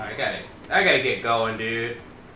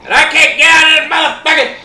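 fast, high, loud rant; frustrated shout; 8 semitones higher, 8 dB louder, faster than usual. Real, not acted. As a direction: shout it in a rush, trapped and furious. Trapped, shouting at the game.

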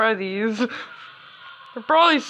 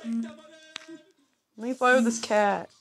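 slow, deep put-on voice; 5 dB quieter, much slower than usual. Shown as a demonstration. Player pleading to fly with the cat, slow and deep. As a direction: drag out "Let me fly with this cat" low and slow. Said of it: "No, I want to fly".

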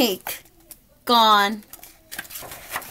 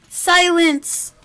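commanding; 6 semitones higher than usual. Acted. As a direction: bark the order for silence. Walrus silencing.

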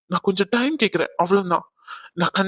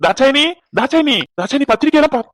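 calling out; 6 semitones higher, 6 dB louder than usual. Acted. Husband calling wife with good news.

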